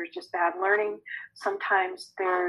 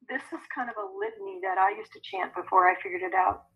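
normal talking voice

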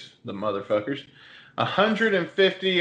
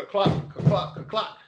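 clicked horse hooves, higher; hoof clop imitation; 5 semitones higher, slower than usual. Narrating the Mounties riding up, mouth hoof clops.

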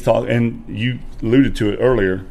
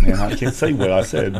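usual speaking voice

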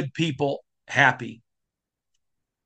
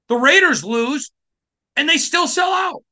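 ranting shout; 9 semitones higher than usual. Real, not acted. Podcast host shouting about fans who still sell out. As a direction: rail at the Lions fans.

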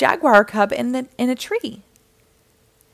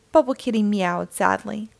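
plain reading voice

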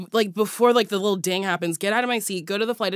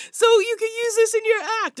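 excited customer service rep; 12 semitones higher, faster than usual. Retold or imitated. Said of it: "And he's like".

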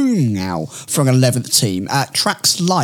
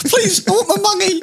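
very high-pitched comic begging; mock pleading. Joking that he's clutching at straws, high mock plea. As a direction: beg in a squeaky put-on voice.

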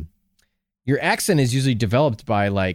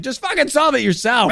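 exasperated; 13 semitones higher than usual. Retold or imitated. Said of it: "I'd be like".

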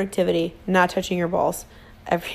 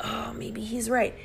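soft, resigned inner voice; inner doubt; 2 semitones higher, 7 dB quieter than usual. Retold or imitated. Wife doubting herself after the fight, soft resigned. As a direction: admit it quietly to yourself. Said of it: "I knew deep inside".

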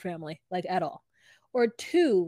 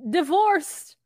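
higher, louder and slow, a grand reveal; proud announcement; 9 semitones higher, 9 dB louder, slower than usual. Retold or imitated. Son presenting his fiancée to his family, grandly and loudly. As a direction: announce it like a triumphant herald.

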